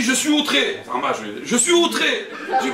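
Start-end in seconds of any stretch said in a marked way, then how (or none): 0.0-0.8 higher-pitched mock outrage; indignant retold complaint; acted indignation. 1.4-2.3 high-pitched hammed-up outrage; theatrical wounded protest; acted indignation. Acted complaint, repeated for comedy.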